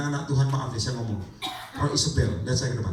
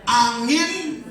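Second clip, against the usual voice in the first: louder, higher fiery preaching; raised pulpit voice building on each phrase; full-force preaching; 6 semitones higher, 6 dB louder than usual. Acted. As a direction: thunder the verse out to the church.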